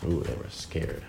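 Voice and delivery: soft scared whisper. A narrator's reading. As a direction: hush it like a secret. Narrator hushes scared wolves.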